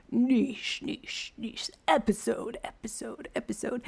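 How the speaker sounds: echoing announcer boom fading out; monster truck voice. Pretend. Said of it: "think of, like, monster truck voice right now".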